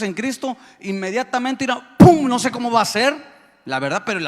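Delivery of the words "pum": sudden loud, high "pum"; shouted imitation of a blast; explosive boom noise. Shown as a demonstration. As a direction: burst out with a vocal bang. Imitates sudden rising of dead.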